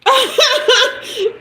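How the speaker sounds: a high burst of real laughter. Real, not acted. Reacting to chat stuck on the wine, a high real laugh.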